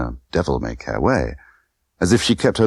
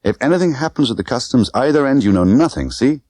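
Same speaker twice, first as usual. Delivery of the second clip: urgent; 7 semitones higher than usual. Acted. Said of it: "her voice was urgent".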